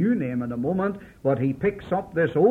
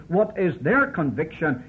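ordinary talk

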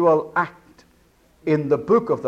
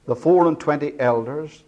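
normal talking voice

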